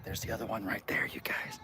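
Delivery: hushed whisper. Real, not acted. Pointing out the other cat in a whisper. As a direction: whisper not to scare them. Sneaking near cats.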